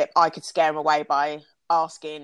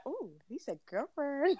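put-on high, soft, drawn-out girlish glee; giddy swooning; 8 semitones higher, 9 dB quieter, slower than usual. Woman privately thrilled he called her girlfriend, giddy. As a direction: coo it, breaking into a giggle. Acts out giddy reaction to being claimed.

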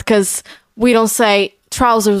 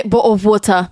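quick British "bottle of water" with dropped t; British-leaning accent; 2 semitones lower than usual. Shown as a demonstration. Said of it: "now I say".

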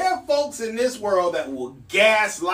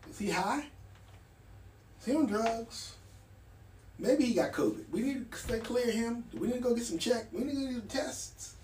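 much softer, murmured among colleagues; hushed worried gossip; 2 semitones higher, 11 dB quieter, faster than usual. Retold or imitated. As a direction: whisper behind his back. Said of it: "everybody in the room is looking at me like".

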